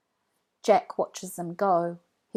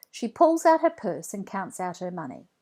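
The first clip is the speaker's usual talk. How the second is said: ordinary delivery, nothing marked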